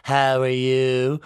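slow drawn-out greeting; slowed-down mum. Retold or imitated. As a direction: stretch each word like playback stuck at slow speed. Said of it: "she's talking to me saying".

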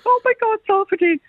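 high gasp of alarm; a sudden high panicked gasp; horrified realisation. Retold or imitated. Caller remembering pills in her bag, high alarmed gasp.

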